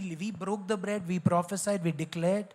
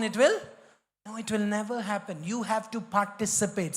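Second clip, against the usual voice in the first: ordinary talk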